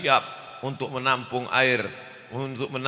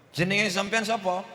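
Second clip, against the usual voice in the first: Javanese examiner; 8 semitones higher than usual. Acted.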